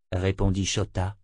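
ordinary delivery

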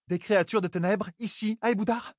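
high, surprised question; can't believe it; astonished. Acted. Merilil astonished at the monsters, high voice. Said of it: "s'étonna Merilil".

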